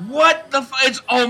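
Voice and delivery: shocked. Real, not acted. Mock shock.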